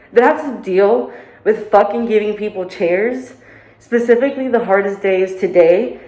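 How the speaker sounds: loud, fed up, near tears; venting hard, on the edge of crying; angry rant. Real, not acted.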